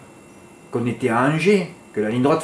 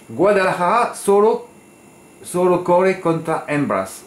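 slow, higher Spanish accent with rolled emphasis on "solo"; 5 semitones higher, slower than usual. Shown as a demonstration. Tipster quoting his Spanish message to the jockey, Spanish accent. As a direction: say "Guadalajara solo corre contra hembras" as a native Spanish speaker would, slow and lifted. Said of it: "J'ai perdu mon accent".

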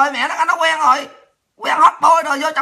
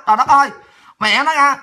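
ordinary talk

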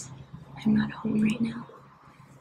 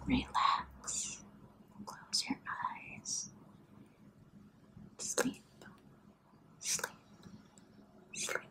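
soothing; 4 semitones higher, 9 dB quieter, slower than usual. Acted. Lulling viewer to sleep.

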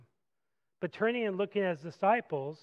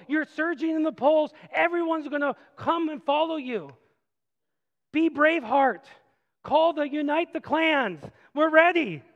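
much higher and louder, rallying cry; eager zealot; 8 semitones higher, 7 dB louder than usual. Acted. Peter rallies Jesus to war, higher and louder. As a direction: fire up the troops like a zealous follower.

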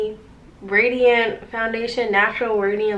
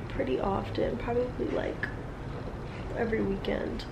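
whisper; 12 dB quieter than usual. Real, not acted. Playful confession whispered.

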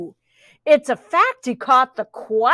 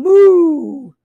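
loud, raised, drawn-out moo; mooing pig; 5 semitones higher, 7 dB louder than usual. A narrator's reading. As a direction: moo like a cow. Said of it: "and the pig going".

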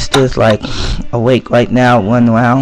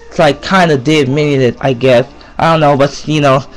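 ordinary delivery

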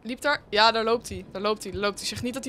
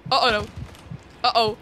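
ordinary delivery

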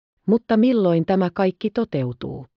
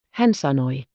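plain reading voice

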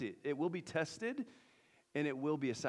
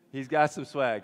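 louder repeat; 9 dB louder than usual. Real, not acted. Repeats line louder for the crowd.

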